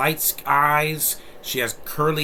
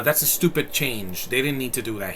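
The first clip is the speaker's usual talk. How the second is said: ordinary delivery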